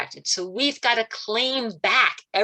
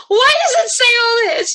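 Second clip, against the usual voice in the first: much higher and louder, calling out to Gabriel; excited high call; 9 dB louder than usual. Retold or imitated. Calling out to Gabriel with his question, high and loud. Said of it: "And I went".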